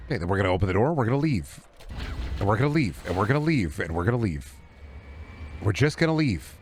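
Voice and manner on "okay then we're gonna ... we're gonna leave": ordinary delivery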